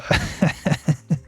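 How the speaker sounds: amused chuckle; real, spontaneous laugh. Real, not acted. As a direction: laugh to yourself mid-thought. Laughing at getting hungry over crab.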